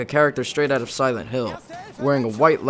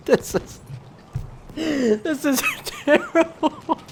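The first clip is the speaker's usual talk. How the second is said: laughing; 14 semitones higher, much slower than usual. Real, not acted. Laughing at own old entry.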